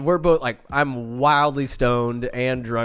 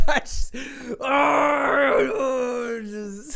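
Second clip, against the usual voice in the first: high squealing moans; hammy high moans trailing off; over-the-top moaning; 11 semitones higher, much slower than usual. Retold or imitated. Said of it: "over the top".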